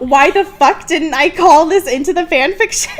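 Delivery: laughing. Real, not acted. Laughing exasperated realization.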